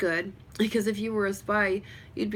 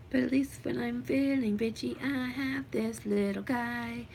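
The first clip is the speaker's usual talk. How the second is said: higher, slowing pet-talk voice; cutesy cooing; 4 semitones higher than usual. Real, not acted. Showing off the little guy, cutesy cooing. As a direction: coo fondly, stretching the words out.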